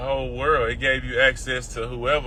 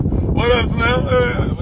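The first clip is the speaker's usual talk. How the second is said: higher, louder greeting shouted out; calling out; 9 semitones higher, 4 dB louder, much slower than usual. Real, not acted. Greeting a passerby from the car, loud and high. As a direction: hail a friend passing by.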